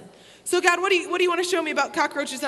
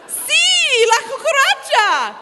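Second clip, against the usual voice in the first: much higher, louder laughing yell; excited shout; 11 semitones higher, 8 dB louder, much slower than usual. Retold or imitated. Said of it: "host mom shouting with laugh".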